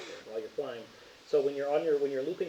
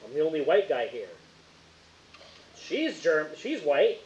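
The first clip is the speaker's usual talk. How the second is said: mocking imitation; 3 semitones higher, 7 dB louder, slower than usual. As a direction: parody a friend's complaint, loudly. Mockingly re-enacts friend's quote.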